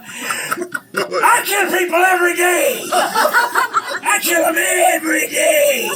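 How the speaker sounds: high, slow, shouted bragging; boastful murderous creature. Acted. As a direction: yell it as a crazed little killer, stretching the words.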